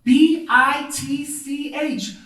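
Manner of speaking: loud, high, letter by letter; slow spelling out. Retold or imitated. Teacher reading a student's writing on the wall, slow loud spelling. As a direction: spell it out very slowly and emphatically.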